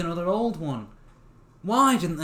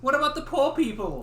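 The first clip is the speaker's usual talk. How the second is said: mock outrage; 10 semitones higher, 3 dB louder than usual. Real, not acted.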